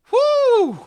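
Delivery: high, loud whoop of joy; excited whoop. Acted. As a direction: whoop it out, thrilled. Character celebrates surviving death.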